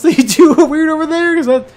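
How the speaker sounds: laughing question. Real, not acted. Laughing, stunned co-host.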